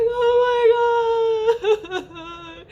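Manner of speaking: very high, hugely stretched mock sob; tearful wail. Mother being handed her newborn, tearful imitated wail. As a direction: wail it out high and long, breaking into laughter.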